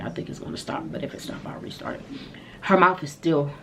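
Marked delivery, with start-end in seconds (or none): 0.0-2.1 breathy whisper, just air, no voice; whisper aside. Real, not acted. Vlogger worried the camera will stop, whispering. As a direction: murmur it under your breath to yourself.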